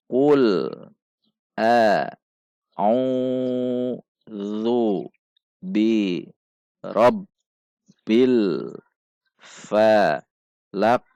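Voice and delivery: dieja lambat per suku kata, berjeda; panjang pendek bacaan. Shown as a demonstration. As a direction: perlihatkan panjang pendek tiap potongan, agak keras. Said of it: "perhatikan panjang dan pendek bacaan". (bacaan terputus-putus untuk murid)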